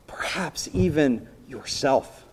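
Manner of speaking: slow hushed whisper. Acted. Preacher confiding to the congregation, whispered. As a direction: let it drop to a slow secret whisper.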